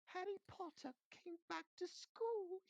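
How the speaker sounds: high, slow elf voice, unhappy; a squeaky elf mourning; Dobby laments to Harry in a high, slow voice; miserable house elf. A narrator's reading. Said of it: "he whispered miserably".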